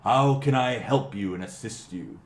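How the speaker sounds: a tavern keeper eager to serve; a hint of the innkeeper. Innkeeper offers the party help, lightly voiced.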